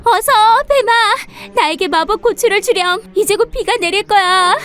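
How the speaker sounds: high, pleading dragon voice; urgent young dragon. Acted. Dragon begs snake for the pepper before rain, high urgent voice.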